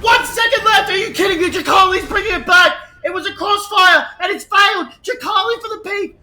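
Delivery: loud, high hyped yelling; excited shouting. Real, not acted. Caster shouting the last second of the round. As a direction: roar the final seconds.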